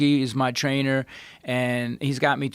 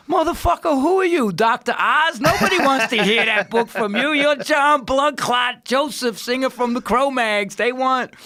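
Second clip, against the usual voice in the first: much higher, louder mocking partner voice; imitating business partner; 9 semitones higher, 3 dB louder than usual. Business partner scoffing at the health book title, high mock. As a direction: blurt it like an incredulous friend.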